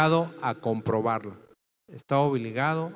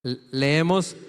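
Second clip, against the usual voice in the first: usual speaking voice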